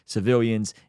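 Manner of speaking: usual speaking voice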